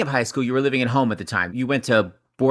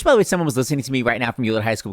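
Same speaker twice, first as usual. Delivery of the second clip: usual speaking voice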